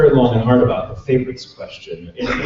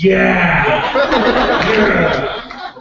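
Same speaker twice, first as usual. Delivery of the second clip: high, laughing imitation of a thrilled crowd; mock delighted cheer; 8 semitones higher, 3 dB louder than usual. English listeners reacting to the French laugh, mock delighted. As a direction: play an audience cheering a party trick.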